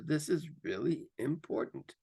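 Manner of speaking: slow it right down so it lands; slowed down for emphasis. Shown as a demonstration. Coach showing the class how slowing down adds emphasis, slow and deliberate. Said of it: "I might just slow it down".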